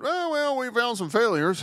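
high, airy, faux-innocent shrug; high-pitched mock officialdom; mock sheepish admission. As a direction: play the state brushing it off sweetly. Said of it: "Iowa then looked at itself and went".

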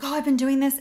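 nervous realtor bragging to a client, lightly mocked; mock boastful agent. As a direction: send up an agent qualifying herself. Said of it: "they're like".

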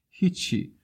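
put-on small, high-pitched crying boy; high voice of a tearful boy, brushing it off. A narrator's reading. Weeping boy answers the worried stranger, tearfully.

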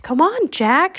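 high, urging raven call; urgent croak. A narrator's reading. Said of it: "she croaked".

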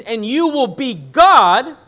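lightly voiced serpent claiming godhood; serpent's alternative. Shown as a demonstration. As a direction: say it as the serpent might have. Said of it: "if he had mentioned".

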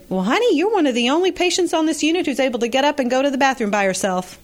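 put-on high, fast Southern accent; imitating a Southern nurse, quick and bright in pitch. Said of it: "she said in her Southern accent".

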